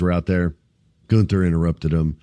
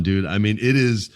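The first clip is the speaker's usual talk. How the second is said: ordinary talk, nothing marked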